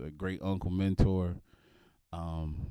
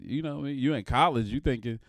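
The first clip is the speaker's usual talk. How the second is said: normal talking voice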